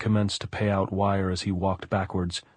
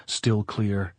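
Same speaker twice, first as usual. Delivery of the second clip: plain reading voice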